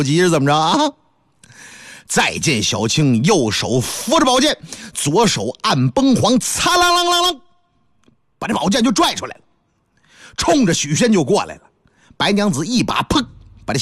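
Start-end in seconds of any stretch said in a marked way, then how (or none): 6.4-7.4 sword-drawing ring. Acted. Sword drawn sound effect.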